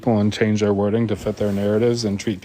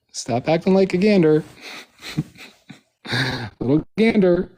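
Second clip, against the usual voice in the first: higher, laughing mockery; laughing taunt; 4 semitones higher than usual. Real, not acted. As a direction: tease through laughter.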